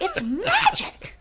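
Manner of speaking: very high and slow, drawn out; awed gush. Retold or imitated. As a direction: stretch it out in wonder. Retelling his awe at food.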